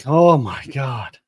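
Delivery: slow drawn-out mock dismay. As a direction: drag it out, horrified. Retelling own reaction to footage.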